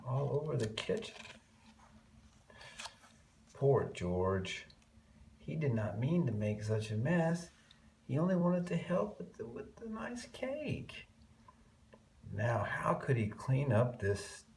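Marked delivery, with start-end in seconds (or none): none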